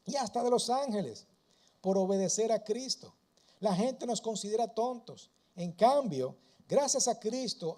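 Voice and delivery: loud, mocking reading of Paul's reproach; ironic rebuke. Preacher voicing Paul ironically scolding proud church, sarcastic. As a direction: read it as biting irony, almost preaching. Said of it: "Pablo irónicamente le está diciendo".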